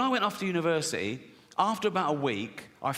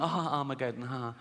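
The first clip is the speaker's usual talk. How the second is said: soft, half-hearted fake chuckle; mock laughing along; 3 semitones lower, 4 dB quieter than usual. Couple watching a comedy routine, softly laughing along. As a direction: imitate uneasy laughing along. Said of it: "they're sort of laughing along".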